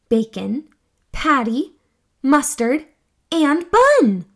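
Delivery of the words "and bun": usual speaking voice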